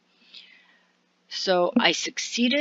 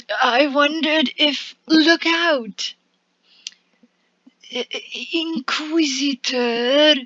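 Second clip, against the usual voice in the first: startled; 7 semitones higher, 3 dB louder than usual. Acted. Bard startled mid-gossip.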